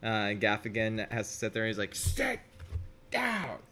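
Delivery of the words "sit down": high barked sit down; mock stern order. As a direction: bark the order in a cartoonish voice. Quoting Gaffigan barking order.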